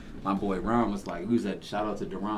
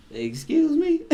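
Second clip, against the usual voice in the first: high, loud indignant squeak; mock outraged boss; indignant excuse me; 6 dB louder, slower than usual. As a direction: act the boss's offended disbelief. Boss offended by customer.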